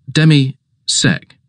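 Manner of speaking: Demisec drawn out slowly, syllable by syllable; slow patient repeat. Acted. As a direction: spell it out patiently for the master. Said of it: "Forgive me, sir".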